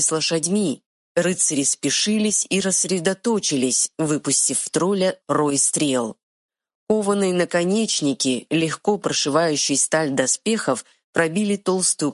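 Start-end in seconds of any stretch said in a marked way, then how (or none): none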